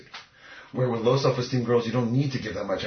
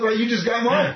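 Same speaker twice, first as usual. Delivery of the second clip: mocking a girl's protest, pitched much higher; higher, lightly hammed-up woman's retort; indignant woman; 11 semitones higher, 4 dB louder than usual. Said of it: "she's gonna be like".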